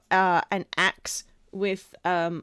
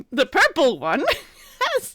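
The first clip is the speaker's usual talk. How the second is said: raised, louder, breaking into laughter; laughing; 5 semitones higher, 5 dB louder than usual. Real, not acted. Game master introducing the purple one's reaction, cracking up laughing. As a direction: try to narrate through a fit of laughter.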